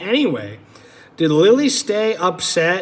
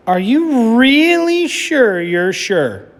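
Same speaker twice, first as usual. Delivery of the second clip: higher, slow, leaning on really; insistent Lily; 5 semitones higher, 4 dB louder, slower than usual. Acted. Lily asks Ginger a third time, slow and pleading. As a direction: drag out the doubt, pleading.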